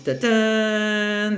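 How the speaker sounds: da-dun like a movie reveal; mock drumroll sting; dramatic sting. Portal cracks open, sound effect.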